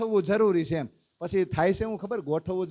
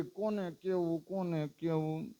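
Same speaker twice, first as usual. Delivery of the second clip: a soft, slow devotee wondering whom to tell; slow hushed musing; 8 dB quieter, slower than usual. Acted. Devotee full of divine knowledge, wondering aloud who will listen, slow and hushed. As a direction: let each question hang quietly.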